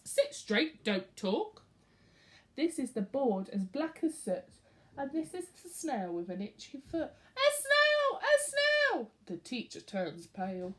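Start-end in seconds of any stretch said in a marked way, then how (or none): 0.1-1.6 mild stern teacher; a hint of a strict teacher; lightly voiced classroom order; teacher's order. A narrator's reading. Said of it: "telling the class". 7.4-9.1 high, loud squeals; children shrieking at the snail; excited shout. A narrator's reading.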